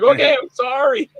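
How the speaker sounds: very high, meek mock apology; cowed apology. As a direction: squeak out an apology as if just yelled at. Scolded actor gives in to director.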